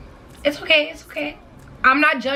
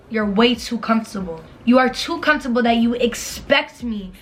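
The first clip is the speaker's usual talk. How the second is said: ordinary talk, nothing marked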